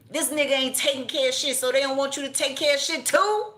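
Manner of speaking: rising outrage as she builds to the question; incredulous rant; indignant. Real, not acted. Indignant rant about drug users.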